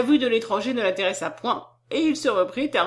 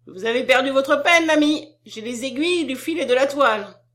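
loud, raised peasant woman's call; higher, forceful exclamation; crying out; 4 semitones higher, 6 dB louder than usual. A narrator's reading. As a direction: shout it across to a pedlar. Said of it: "elle s'écria".

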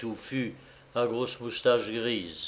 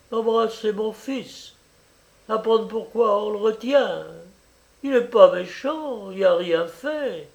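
high, pitched-up mother's plea; anxious old mother begging; pleading mother; 7 semitones higher than usual. A narrator's reading. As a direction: plead for your son in a raised pitch. Mother pleading to see her son.

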